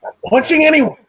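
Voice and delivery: high, loud exasperated veto; scolding. Acted. Guardian forbidding punching, high loud scolding.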